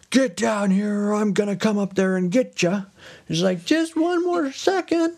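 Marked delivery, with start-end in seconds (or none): 0.1-2.8 high-pitched, strained threatening holler; mock-furious husband's call; Bluebeard. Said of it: "Bluebeard is like". 3.6-5.1 very high, drawn-out squeal; hammed-up high plea; pleading wife. Wife pleads again, higher.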